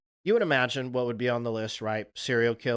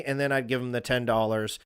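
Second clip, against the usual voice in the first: usual speaking voice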